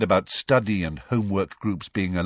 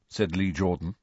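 usual speaking voice

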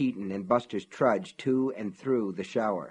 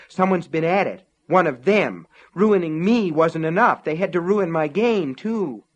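loud, higher bitter outburst; raging inner cry; 6 semitones higher, 8 dB louder than usual. A narrator's reading. Man finding his game ruined, raging aloud. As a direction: spit out the betrayal. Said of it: "his mind cried".

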